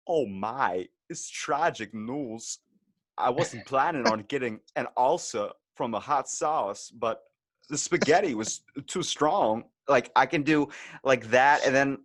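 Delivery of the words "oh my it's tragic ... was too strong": celebrity impression in halting, stretched phrases; Christopher Walken. Shown as a demonstration. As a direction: do the impression with odd pauses, drawn-out words. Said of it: "got my Christopher Walken pretty down pat".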